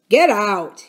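loud, irritated order; irritable. A narrator's reading. Uncle Jocelyn thinking he is speaking to Joe, irritable. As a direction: send him away with a touch of temper. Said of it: "felt more irritable".

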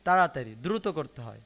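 normal talking voice

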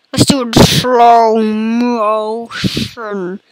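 mock slow-motion playback drawl. Gamer announces going into slow motion, slow motion voice. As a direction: drag it out, very slow. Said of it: "I'm doing the slow motion voice".

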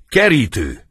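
loud, high stage shout; acted angry outburst; hurled insult. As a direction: fling the accusation out sharply. Insult hurled during the fight.

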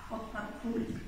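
ordinary delivery, nothing marked

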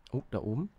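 normal talking voice

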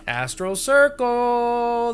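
high, slow, put-on voice for the NPC; playful put-on voice; mock character voice. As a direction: drag out the words like a silly guest. Character voice mocking address.

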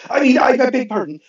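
high, flustered, tripping over the words; panicked. Acted. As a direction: blurt the apology in a panic. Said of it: "He gasped, panicked".

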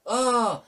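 loud, high "oh" of shock; startled cry. Real, not acted. Player shocked by what is in the sink, startled cry. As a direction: cry out in sudden surprise.

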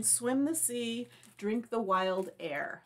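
ordinary delivery

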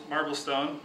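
ordinary delivery

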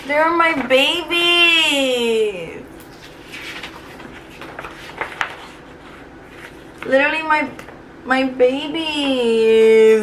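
real tears welling while speaking about her students; tearful. Real, not acted. Teacher to viewers, overcome with emotion, slow tearful voice. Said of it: "I literally start to tear up".

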